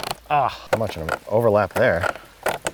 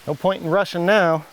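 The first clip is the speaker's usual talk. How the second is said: normal talking voice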